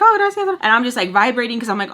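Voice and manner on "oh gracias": high, louder mock-polite voice; put-on sweet thanks, pitched way up; high-voiced retold politeness. Her polite reply, acted.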